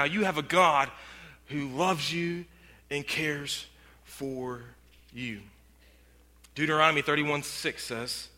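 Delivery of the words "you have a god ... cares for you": soft, slow and tender; tender hush. Real, not acted. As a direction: sink the voice to a gentle hush. Tender close of sermon point.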